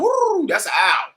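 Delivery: high, slow hoot then a plain aside; owl hoot imitation. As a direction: do a quick high owl call. Shows what an owl sounds like.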